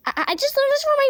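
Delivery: flustered high stammer. Acted. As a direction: blurt it out all flustered.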